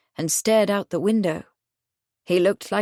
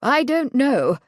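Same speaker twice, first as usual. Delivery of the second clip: nervous; 6 semitones higher, 4 dB louder, slower than usual. A narrator's reading. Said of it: "Marconi said nervously".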